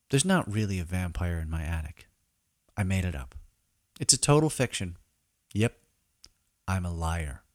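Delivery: usual speaking voice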